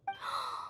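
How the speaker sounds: a quick breathless intake of surprise; soft surprised gasp. Acted. Klee spotting the lantern, a soft gasp. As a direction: gasp softly at something pretty.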